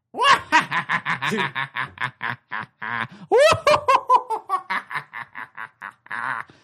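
long, very loud uncontrollable laugh; the policeman can't stop laughing; laughing policeman's laugh. Acted. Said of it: "And he says".

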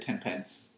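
TEN PENCE as two plain, full words; normal pronunciation. Shown as a demonstration. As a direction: say "pence" fully, with no airs. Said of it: "what normal people say".

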